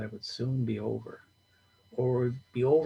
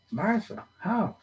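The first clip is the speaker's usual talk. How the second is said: Martha? flung high, stunned; disbelieving high cry; shocked; 11 semitones higher, slower than usual. A narrator's reading. John hears Martha died, shocked high question.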